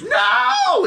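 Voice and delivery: very high, drawn-out acted scream. Wounded old lady drops to her knees, screaming. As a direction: shriek it as the defeated villainess. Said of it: "and she screams".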